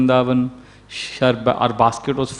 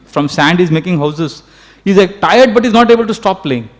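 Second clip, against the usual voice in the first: ordinary talk, nothing marked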